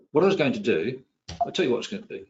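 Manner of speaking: ordinary delivery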